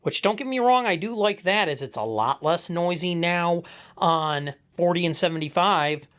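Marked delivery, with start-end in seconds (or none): none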